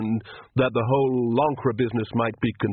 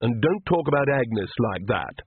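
plain reading voice

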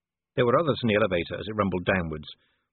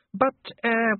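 high, faltering dwarf voice; flustered Dee; 12 semitones higher than usual. A narrator's reading. Dwarf trying to interrupt the duchess, caught off guard. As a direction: begin an objection high and uncertain. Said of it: "caught off guard by the charm".

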